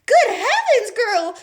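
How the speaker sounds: high, loud shriek of alarm; a mother shrieking in shock. A narrator's reading. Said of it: "shrieked Miss Beauregard suddenly".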